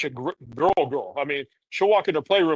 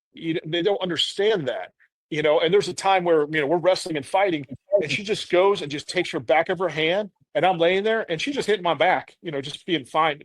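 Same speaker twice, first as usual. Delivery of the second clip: usual speaking voice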